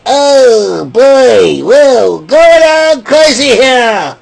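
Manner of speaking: hammed-up dismay; mock alarm. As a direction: play a panicked onlooker, stretched out. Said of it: "It's like".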